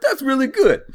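very high cartoon Jersey voice; Jersey accent. Storyteller defends the driver, hammed-up Jersey accent. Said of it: "The voice you're doing".

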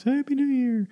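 very high, squeaky cartoon mouse greeting; high falsetto Mickey Mouse cheer. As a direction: chirp it like the cartoon mouse at the castle. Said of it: "and the Mickey Mouse".

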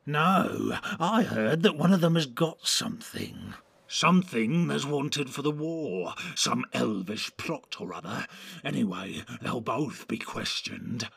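lightly voiced orc passing on rumours; a hint of an orc in the know.

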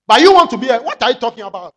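loud mocking confrontational voice; mock angry retort. Preacher acting out a quarrelsome believer, loud and mocking. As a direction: imitate a believer picking a fight.

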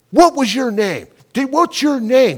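loud, high retold demand; mad. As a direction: imitate a furious kid demanding an answer at a shout. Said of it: "He got so mad".

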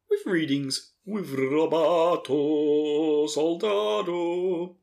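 slow, drawn-out mock announcer intro. Host handing over to Rob, hammed-up announcement. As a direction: stretch it out like a grand show intro.

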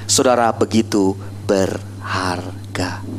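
slow, low near-whisper; tender whispered affirmation; hushed assurance. Real, not acted. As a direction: draw it out softly, word by word. Preacher tenderly assures the congregation.

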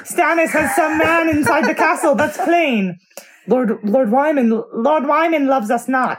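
high, loud hammy lord; clown voice. Acted. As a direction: ham it up like a clownish noble. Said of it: "getting the clown voice".

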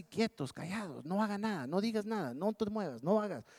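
soft, hushed string of prohibitions; keeping them quiet. Acted. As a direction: act out hushing people into stillness. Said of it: "Nos quiere mantener así".

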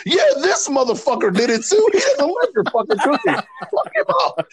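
high, laughing put-on voice; hammed-up high-pitched wrestler rant; high mock rant. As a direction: squeak out an angry rant. Retold Brody Lee rant.

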